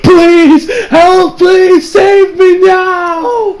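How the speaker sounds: high, loud mock wail for help; mock crying plea that builds to a shout; hammed-up begging cry. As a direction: fake a desperate plea, crying out. Kira begs Jotaro for help.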